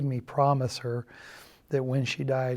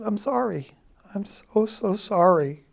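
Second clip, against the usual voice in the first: mother sobbing, higher, a mild echo of her tears; retold sobbing; 6 semitones higher than usual. Mother sobbing an apology to her son on the phone, mildly imitated. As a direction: voice the grieving mother lightly, pitched up. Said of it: "she's sobbing".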